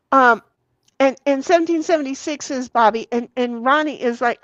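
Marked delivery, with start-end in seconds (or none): none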